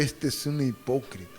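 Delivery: quiet inner judgment. Retold or imitated. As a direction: murmur it to yourself. Inner voice thinking.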